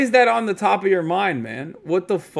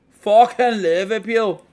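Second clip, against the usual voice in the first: mock Scouse; 6 semitones higher, 5 dB louder, slower than usual. Hammed-up Scouse exclamation.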